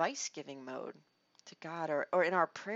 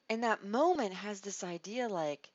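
usual speaking voice

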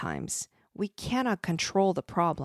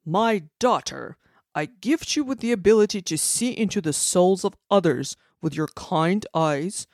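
loud proclamation; 6 dB louder than usual. Acted. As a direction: proclaim it for all to hear. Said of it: "he proclaimed loudly for all to hear".

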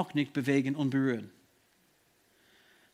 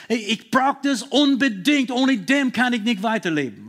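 louder and higher, begging with everything; desperate pleading; 4 semitones higher, 4 dB louder than usual. Retold or imitated. Jairus begging Jesus for his dying daughter, desperate pleading. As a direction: plead as if life depends on it. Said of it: "das ist Flehen".

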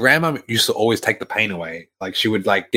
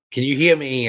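higher-pitched mock yell, volume held back; retold yell; 6 semitones higher than usual. Calling to grandma in the hospital, half-hearted retold yell. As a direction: imitate calling out to someone hard of hearing. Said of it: "yelling at the top of my lungs".

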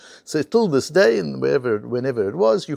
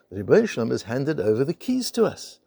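usual speaking voice

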